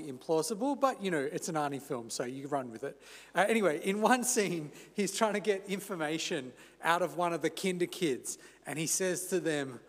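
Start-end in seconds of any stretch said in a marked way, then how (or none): none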